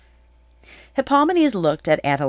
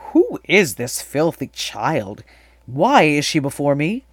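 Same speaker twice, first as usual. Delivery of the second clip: slow, louder royal demand; imperious king; 5 dB louder than usual. A narrator's reading. King asks about the child standing before him, imperious demand. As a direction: question them as a king displeased by an intrusion.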